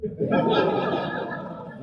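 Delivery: laughing. Real, not acted. Laughs at own joke.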